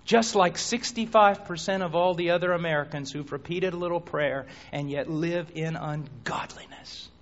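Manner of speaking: ordinary delivery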